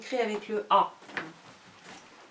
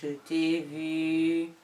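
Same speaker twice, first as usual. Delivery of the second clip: slow, drawn-out teasing; slow playful tease; 4 semitones lower, much slower than usual. Real, not acted. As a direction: tease slowly, I caught you. Teasing the child on camera.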